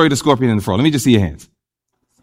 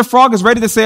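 ordinary delivery

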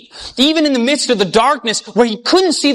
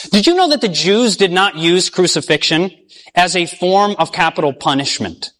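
normal talking voice

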